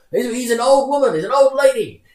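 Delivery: very high, loud mock heckle; gleeful crowd imitation. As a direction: shout it out like a delighted audience member. Acting out audience's gleeful casting call.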